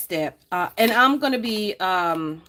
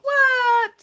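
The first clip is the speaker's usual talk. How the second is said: mock surprise; 4 dB louder than usual. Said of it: "I was like".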